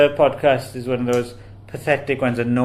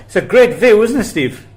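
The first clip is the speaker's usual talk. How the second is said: nervous interviewer; 8 semitones higher, 6 dB louder than usual. Retold or imitated. Nervous interviewer's gaffe, retold.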